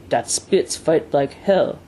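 put-on slow French-Canadian accent; broken English, drawn out with a French-Canadian accent; lightly voiced French-Canadian courier, slow; Perrault. A narrator's reading. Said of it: "said Perrault as he surveyed".

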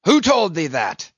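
loud, sharp demand; stung captain's outcry; cried out. A narrator's reading. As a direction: fire back the question, high and loud. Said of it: "cried Ahab".

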